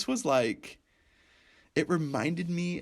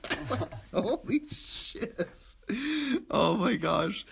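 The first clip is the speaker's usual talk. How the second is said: breathless laugh; 9 semitones higher, much slower than usual. Real, not acted. Laughing aftermath of quote.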